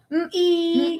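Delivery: "Mm-ee" said slowly and high; teaching sound. Shown as a demonstration. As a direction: demo "Mm-ee" again for the child. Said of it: "No, look over here".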